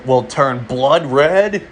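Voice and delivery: ordinary delivery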